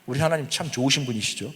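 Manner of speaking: normal talking voice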